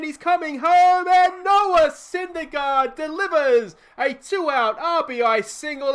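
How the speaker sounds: high, loud commentator's shout; ecstatic play-by-play. Real, not acted. Commentator cheers Syndergaard's hit as the lead is taken, ecstatic shouting. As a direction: roar the go-ahead hit, easing slightly at the end.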